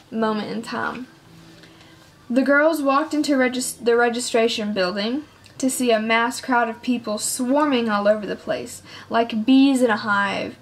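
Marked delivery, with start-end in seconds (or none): none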